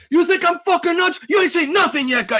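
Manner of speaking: high, loud indignant outburst; put-on furious challenge; acted customer yelling. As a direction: yell it way up high, as if insulted. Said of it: "more yelling".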